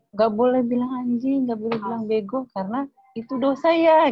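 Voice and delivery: a lightly voiced little kid repeating a lesson; mildly imitated child scolding himself; child reciting rule. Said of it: "dia sekali bilang gini".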